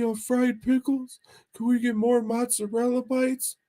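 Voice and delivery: squeaky goofball ordering food; Mahoney impression. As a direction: ham up your buddy ordering, way up high. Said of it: "It's a good impression".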